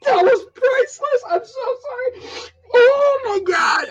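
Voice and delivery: high squeal, breaking up with laughter; real squealing hilarity. Real, not acted. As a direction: squeak it out, overcome with amusement. Helpless reaction to a funny impression.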